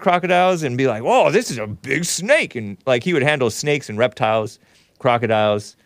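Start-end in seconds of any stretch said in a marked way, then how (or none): 1.0-2.6 imitated passion. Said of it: "He was very passionate".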